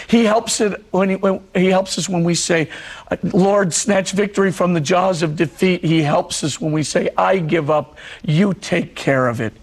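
ordinary talk, nothing marked

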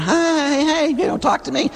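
a spouse pleading to be noticed, then hushed; neglected spouse. Retold or imitated. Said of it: "your spouse sitting there going".

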